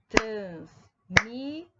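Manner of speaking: one spaced beat per syllable of "Transmissão", as if to claps. Shown as a demonstration. Teacher clapping out syllables for the class, "Transmissão" in three beats. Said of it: "fazer o teste também batendo palmas".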